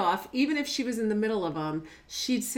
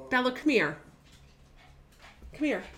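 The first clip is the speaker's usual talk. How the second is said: calling the dog; 4 semitones higher, faster than usual. Real, not acted.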